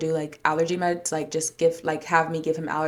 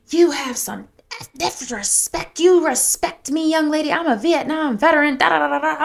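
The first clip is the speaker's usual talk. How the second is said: high, loud mock scolding; indignant older man; 9 semitones higher, 4 dB louder than usual. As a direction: imitate a veteran's outraged lecture, dragging out the demand for respect. Imitates older veteran scolding her.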